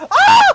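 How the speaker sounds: very high, loud shriek; short piercing yelp of alarm; startled scream. Real, not acted. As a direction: scream out suddenly. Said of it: "I'm going to clip that sound effect".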